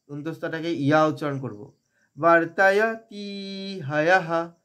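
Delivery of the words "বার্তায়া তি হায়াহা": "তি" stretched in a slow chant, "হায়াহা" ending in breathed ha; long তি. Shown as a demonstration. Teacher showing the long syllable, "তি" drawn out. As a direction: intone "বার্তায়া তি হায়াহা", holding "তি". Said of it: "তি দীর্ঘ করে".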